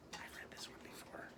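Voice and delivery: soft confiding whisper. Acted. Teacher confiding to children in a whisper. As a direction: lean in and whisper it.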